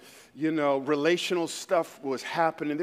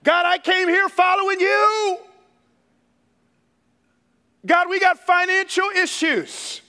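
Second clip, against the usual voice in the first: high, raised-voice protest in prayer; impassioned outcry to God; 11 semitones higher, 7 dB louder than usual. Acted. Church planter under attack praying to God, impassioned outcry. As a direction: plead loudly, demanding that God act. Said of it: "I'm talking out loud".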